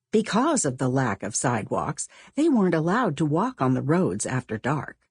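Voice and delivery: ordinary delivery, nothing marked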